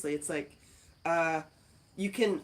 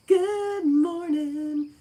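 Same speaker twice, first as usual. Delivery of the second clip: much higher, slow sing-song greeting; 11 semitones higher, 8 dB louder, much slower than usual. Acted. Greeting a live viewer, high drawn-out sing-song. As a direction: stretch it out high and sweet.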